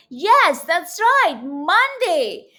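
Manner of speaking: ordinary delivery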